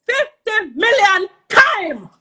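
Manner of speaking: loud, high and drawn out; real exasperated yell; worked-up outburst. Real, not acted. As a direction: stretch each word out in outrage. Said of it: "Calm down".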